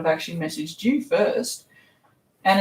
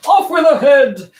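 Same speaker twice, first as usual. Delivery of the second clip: mock queen; 7 semitones higher, 8 dB louder than usual. Joking about cutting off heads.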